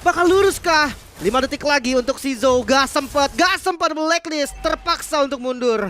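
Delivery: screaming high hype call; esports caster. Real, not acted. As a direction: scream it as the team wipes.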